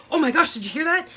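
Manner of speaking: high, loud startled gasp of a question; jolted awake by thunder, reenacting the alarm; startled exclamation. Wife shaking her husband awake after the thunder, startled and loud.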